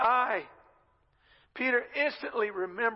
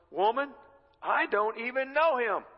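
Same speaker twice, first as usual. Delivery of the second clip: higher, emphatic mock protest; indignant denial; 5 semitones higher, 4 dB louder than usual. Peter brushing off a servant girl's accusation, indignant. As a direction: act out an affronted denial.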